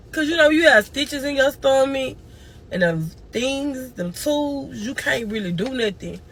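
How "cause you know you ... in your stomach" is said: usual speaking voice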